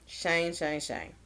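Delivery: low, very soft sigh of his name; weary disappointment. Real, not acted. Viewer sighing over Shane's blunder, weary and hushed. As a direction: sigh it out, quiet and let down. Disappointed in the man she recaps.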